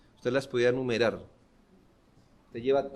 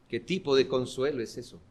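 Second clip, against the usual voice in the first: ordinary delivery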